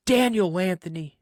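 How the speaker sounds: mock parental yell; retold yell. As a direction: mildly yell the full name like a dad in trouble-mode. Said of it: "I hear him yell".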